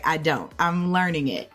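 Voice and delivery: normal talking voice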